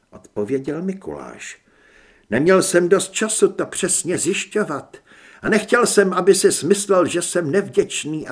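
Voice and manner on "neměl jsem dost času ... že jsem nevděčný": raised, insistent explanation; heated sarcasm. A narrator's reading. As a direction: press the point with controlled anger. Son sarcastically settles his debt. (jízlivě a důrazně)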